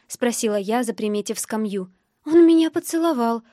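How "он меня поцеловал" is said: high, louder, upset voice; tearful. A narrator's reading. Distraught girl explaining to a stranger, tearful. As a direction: plead your case through tears.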